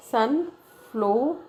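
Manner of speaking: "sunflower" slowly divided, sun, flow, er; "sunflower" pulled apart with gaps after sun and flow; split into syllables. Shown as a demonstration. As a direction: say "sunflower" in slow, separate syllables. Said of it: "is a split into two syllable".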